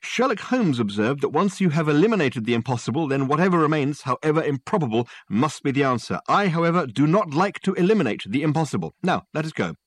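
triumphant show-off lecturing; excited Dirk. Acted.

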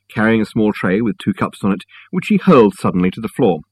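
ordinary delivery, nothing marked

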